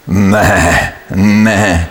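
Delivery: low bleating meh, laughing; sheep bleat. Retold or imitated. Sheep bleating back at David.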